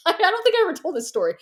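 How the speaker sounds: laughing. Real, not acted. Laughing aside, high and fast.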